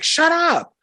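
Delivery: high, sharp mock shout of an annoyed audience; crowd shushing. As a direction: snap at the heckler to shut up. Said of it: "And everybody's just like".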